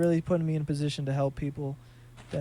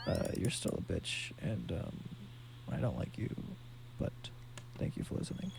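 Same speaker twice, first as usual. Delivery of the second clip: low, whispered mock insult to a friend; teasing whispered aside; 9 semitones lower, 12 dB quieter than usual. Real, not acted. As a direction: breathe it out as a jokey jab.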